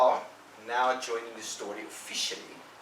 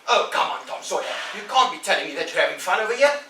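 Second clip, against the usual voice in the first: put-on loud, high, fast protesting boy; perplexed Ben; 8 semitones higher, 11 dB louder than usual. Ben protesting that painting can't be fun, loud. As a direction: protest loudly in disbelief.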